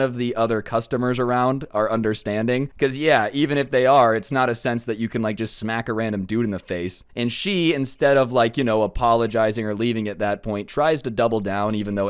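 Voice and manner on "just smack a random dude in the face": ordinary talk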